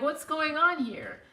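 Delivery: high, loud, slow question; acted-out mock inquiry; nosy approach. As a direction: play at demanding an explanation. Walks up to husband, retold.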